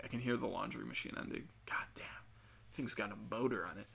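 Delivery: hushed, breathy aside under the breath; whispered aside. Real, not acted. Podcaster muttering about the laundry machine, whispered. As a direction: drop to a whisper for a quick real aside.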